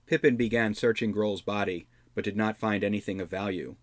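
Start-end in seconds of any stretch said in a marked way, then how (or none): none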